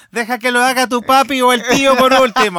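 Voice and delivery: put-on high, loud playful kid voice; mock child apology. Co-host extending the boy's apology, mock child voice. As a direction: pile on as the earnest little boy.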